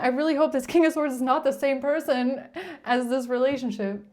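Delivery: ordinary talk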